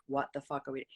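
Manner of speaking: barely voiced dread; retold hushed panic; scared under the breath. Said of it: "I think we were just like".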